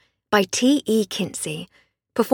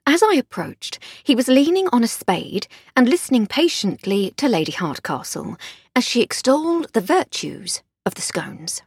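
plain reading voice

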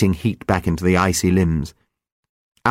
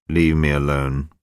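weary dying man; 7 semitones lower than usual. Acted. Dying Albard's voice.

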